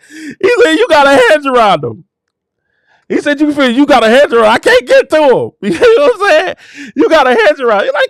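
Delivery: high-pitched rapture near tears; real joy spilling into laughs and sobs; overwhelmed preacher, voice lifted high; happy, overcome. Real, not acted. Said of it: "I'm sorry when I get happy like that".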